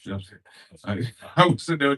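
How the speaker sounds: ordinary talk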